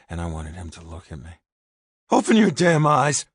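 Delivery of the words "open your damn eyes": loud high furious command; angry shout. A narrator's reading. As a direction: yell it at him, fed up. Furious fight at the grave.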